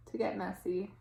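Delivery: soft, whispered to get messy; soft whispered ending; whispered moral. A narrator's reading. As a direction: hush it like a secret. Gentle moral of the story.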